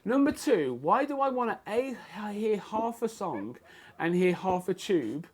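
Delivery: mock angry rant. Fighter retelling his rage at earbud-sharing couple, mock angry rant. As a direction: rant like you're seething on the tube. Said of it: "it made me so mad".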